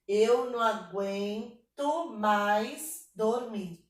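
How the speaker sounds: very slow, syllables drawn out and separated; syllable-by-syllable counting. Shown as a demonstration. Teacher checking the verse's syllable count, slow syllable-by-syllable reading. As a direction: stretch out every syllable of the line. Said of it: "vamos contar aqui".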